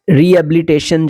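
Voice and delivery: रिहेबिलिटेशन said "ree-abilitation", h dropped; swallowed h. Shown as a demonstration. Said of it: "ह को खा जाते हैं". (रिहेबिलिटेशन में ह खा जाना)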